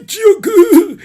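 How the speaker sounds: high, slow stretched shocked cry; anime-style stunned shout; shocked yell. Retold or imitated. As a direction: bellow the number in disbelief. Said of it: "Il dit ça comme ça".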